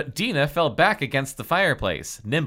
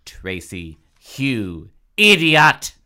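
furious shriek; 10 dB louder, slower than usual. Acted. Dina turns on Tracy, furious shriek. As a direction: build slowly to an explosive last word. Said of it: "Dina shrieked in fury".

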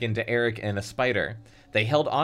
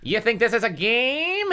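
high, raspy gunman's threat; hammed-up hoarse villain; hoarse rasp; 12 semitones higher, 3 dB louder than usual. A narrator's reading. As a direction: rasp it with menace. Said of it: "rasped in his hoarse voice".

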